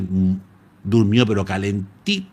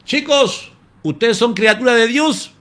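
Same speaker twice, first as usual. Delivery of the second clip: high-pitched, louder call to the lions; Daniel's raised, high plea; retold high shout; 12 semitones higher, 5 dB louder than usual. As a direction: cry it out to the lions, high and loud. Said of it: "bajó gritando y diciendo".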